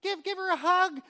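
put-on high cooing voice to a toddler; baby-talk coaxing. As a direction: coo at the babies like a parent. Parent coaxing babies to hug.

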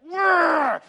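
a sudden high, loud whirr; playful imitated swoosh; loud baby swipe noise. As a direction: blast out a baby's wild swipe. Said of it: "they're just like".

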